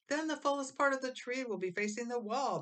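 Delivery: put-on correcting the boys; Mabel's voice. A narrator's reading. Mabel directing her brothers, high and bossy. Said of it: "Mabel said".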